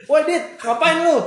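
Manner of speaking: put-on loud high yell at a kid; shouting. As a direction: yell down at the boy in the tree. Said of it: "diteriakin sama Pak B".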